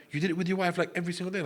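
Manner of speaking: high-pitched disbelieving question; incredulous questioning. Retold or imitated. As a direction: voice the astonished friends, pitch jumping up. Retold shocked friends questioning him.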